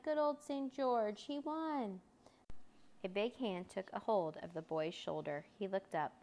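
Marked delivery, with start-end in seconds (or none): 0.0-2.0 high, drawn-out cheer; cheering children's shout. A narrator's reading. Party children cheering the puppet hero, high cheering shout. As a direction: celebrate slowly in a raised, happy pitch. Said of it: "they shouted".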